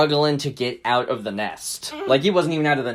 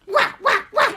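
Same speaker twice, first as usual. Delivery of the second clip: rapid string of "wah" yelps; mock martial-arts yells while flipping; kung fu cries. Storyteller acts turtle's fight cries.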